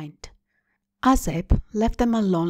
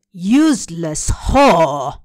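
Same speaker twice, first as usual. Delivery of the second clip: loud, slow, spat-out insult; enraged mother's hateful cry; furious scream; 3 semitones lower, 7 dB louder, much slower than usual. Acted. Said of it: "she screamed".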